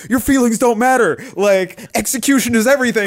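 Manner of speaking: high-pitched mock hustle guru; hammed-up motivator barking hard truths; Gary Vee motivational rant. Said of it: "he's just like".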